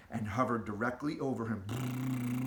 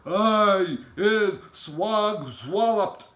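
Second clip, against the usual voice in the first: very slow, higher, loud bellow; bellowing giant; 6 semitones higher, 9 dB louder, much slower than usual. Acted. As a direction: bellow each word out long. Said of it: "bellowed the bone cruncher".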